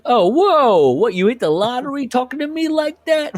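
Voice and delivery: high, loud mock disbelief; hammed-up boss in disbelief; incredulous boss. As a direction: act stunned and outraged. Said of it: "Joe goes".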